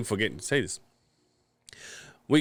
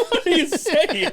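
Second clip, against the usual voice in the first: laughing disbelief; 4 dB louder, slower than usual. Real, not acted.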